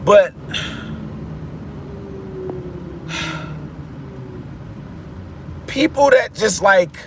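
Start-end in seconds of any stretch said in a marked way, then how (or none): 0.4-5.6 long, soft audible sigh; slow, quiet exhale. Real, not acted. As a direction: let out a drawn-out breath. Long pause mid-rant.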